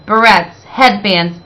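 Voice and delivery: ordinary delivery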